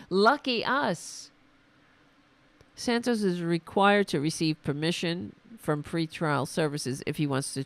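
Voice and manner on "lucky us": sarcastic. Real, not acted. Sarcastic reaction to news.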